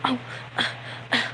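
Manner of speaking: imitating an affronted huff; put-on offended spluttering; mock offended. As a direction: play-act being offended in short, broken sounds. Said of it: "like, offensive".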